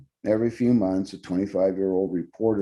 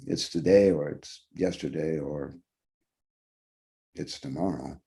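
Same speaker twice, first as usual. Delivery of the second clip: ordinary delivery, nothing marked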